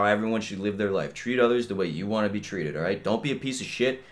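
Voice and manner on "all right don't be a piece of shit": ordinary talk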